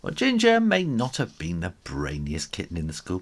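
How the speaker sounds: usual speaking voice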